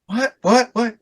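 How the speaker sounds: high, stammered "what, what"; retold baffled surprise. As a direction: sputter it high and confused. Retold confused reaction.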